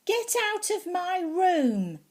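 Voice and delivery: put-on high, loud child's yell; shouting. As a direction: yell it at the little brother, fed up. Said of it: "So I shouted".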